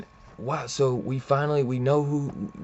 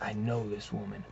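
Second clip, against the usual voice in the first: softly retold dawning recognition; hushed realization; 8 dB quieter than usual. Villain realizing he knows his attacker, hushed.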